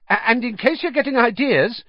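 high, nervous, stammered start; hasty. A narrator's reading. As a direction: hurry to get it in, voice up high. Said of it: "he added hastily".

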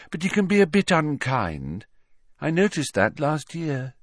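put-on high girl's voice, gently frank; candid Luna.

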